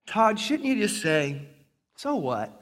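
soft, slow, gentle prompting; quiet voice from the back of the head; little inner voice. Acted. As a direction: play a hushed conscience coaxing. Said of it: "this little voice in the back".

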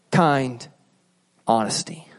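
"Kind honesty" slow, falling soft; emphasized phrase. Shown as a demonstration. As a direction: drop to a hushed "honesty". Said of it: "That's how you speak".

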